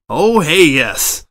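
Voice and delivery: sudden loud, high burst of glee; manic glee. A narrator's reading. Editor cheers about subscriptions with manic glee. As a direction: explode with unhinged delight. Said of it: "Etta attempted to laugh".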